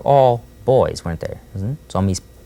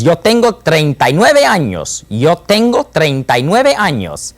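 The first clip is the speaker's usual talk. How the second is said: emphatic insistence; 7 semitones higher, 5 dB louder than usual. Acted. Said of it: "he's very emphatic about it".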